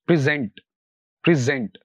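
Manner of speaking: "Present" stressed on gent; last-syllable stress. Shown as a demonstration. Teacher models two-syllable verbs, "present" with stress on gent. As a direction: hit the second syllable of "present", falling. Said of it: "stress होता है वो last syllable".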